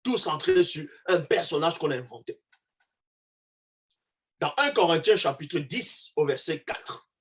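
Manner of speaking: ordinary talk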